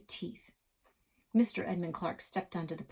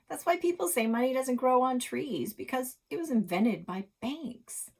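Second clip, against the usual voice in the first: know-it-all; 6 semitones higher, 6 dB louder than usual. Acted. Girl lecturing confidently.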